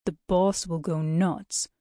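slow, heavy character voice; warning driver. A narrator's reading. Bus driver to guide defying the route, slow warning. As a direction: warn her slowly, disbelieving.

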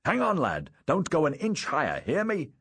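high, urgent call aloft. Acted. Father climbing after his young son, urgent calling out. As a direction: call up a firm warning to a child.

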